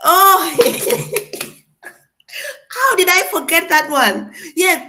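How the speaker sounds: high, loud laughing exclaim; loud, high, laughing surprise. Real, not acted. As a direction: laugh at forgetting it. Remembering word, laughing.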